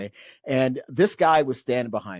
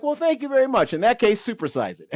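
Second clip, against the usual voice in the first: much higher, bright mock-gracious thanks that drops on the punchline; cheeky thanks; 11 semitones higher, 6 dB louder than usual. Sailor cheekily thanking the old man, high mock-gracious. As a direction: thank him sweetly, then cash in. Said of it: "I'm like".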